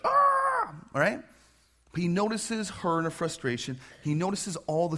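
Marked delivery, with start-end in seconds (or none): 0.0-0.7 very high short exasperated cry; frustrated yell. Retold or imitated. Martha's angry outburst shrieked very high. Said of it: "she's like".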